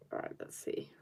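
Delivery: soft unvoiced whisper to herself. Real, not acted. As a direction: breathe it out as a whisper. Whispers to herself while drawing.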